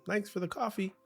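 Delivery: high, sugary thank-you; chirpy fake-nice politeness; sweet polite voice. Partner's sweet thanks to stranger.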